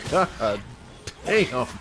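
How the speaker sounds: usual speaking voice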